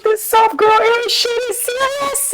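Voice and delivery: very high, squeaky exclamation; mock overwhelmed squeal. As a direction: squeal it as if it's all too much. Exclaims in mock overwhelm, then laughs.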